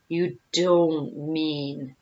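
very slow, low hesitation; dawning dread. A narrator's reading. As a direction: drag out each word, hardly daring to ask. Hippo dawning on horrible meaning.

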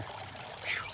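blown-out exhale of effort; whispered relieved puff; breathless phew. Real, not acted. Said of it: "A little out of breath here".

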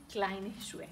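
ordinary delivery

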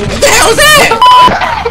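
a slow, high startled cry; shocked in-game yelp. Real, not acted. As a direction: jump at the sight of it. Startled by creature.

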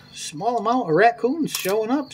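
ordinary talk

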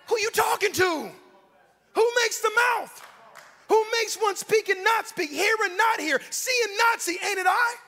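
high, loud thundering challenge; indignant God. Acted. The Lord rebukes Moses for doubting Him, indignant shouting. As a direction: fire off the questions at full shout. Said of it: "the Lord asked Moses".

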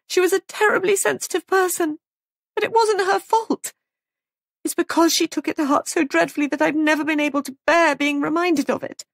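upset woman hotly defending her sister; distraught outburst. A narrator's reading. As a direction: pour it out in a high, agitated voice.

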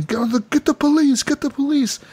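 high alarmed calling out for the law; mock panicked townsfolk. Townsfolk after the knifing, high panicked cry for the police. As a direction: call out in a panic like a frightened bystander.

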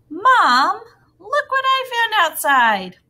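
put-on high, loud excited child-to-mom call; excited exclamation. Child in a book calls Mom to see a find, excited. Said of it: "to show emotion or excitement".

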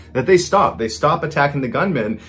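ordinary delivery

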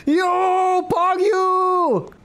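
high excited yell at the screen; excited shout. Real, not acted. Streamer thrilled by new outfit, shouting. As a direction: shout it out in delight.

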